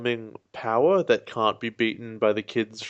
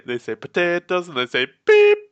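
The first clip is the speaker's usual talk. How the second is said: mocking; 7 semitones higher, 8 dB louder than usual. Mocking the bleeped accent.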